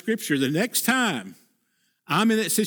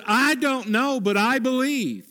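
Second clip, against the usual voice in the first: Elijah's creed; 6 semitones higher than usual. Acted. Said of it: "He goes".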